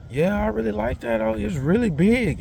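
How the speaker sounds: man mimicking the short woman admiring his truck, high-pitched; high mock woman's voice. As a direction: squeak it out, impressed. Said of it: "she's like".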